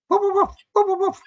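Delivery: very high yappy barks; imitating a dog barking. The dog next door keeping him awake, high yapping barks. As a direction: bark it out like a small dog. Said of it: "And the dog would go".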